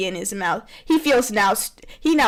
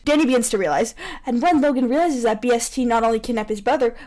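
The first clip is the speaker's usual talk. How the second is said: normal talking voice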